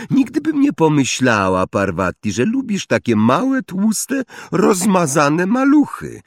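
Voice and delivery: high-pitched, sneering taunt; Pansy Parkinson mocking. A narrator's reading. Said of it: "kpiącym tonem".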